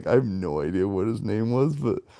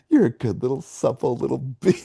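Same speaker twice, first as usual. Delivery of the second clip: normal talking voice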